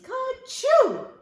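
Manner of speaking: big high acted sneeze; loud sneeze. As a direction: burst out with a squeaky, loud sneeze. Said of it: "Peter sneezed".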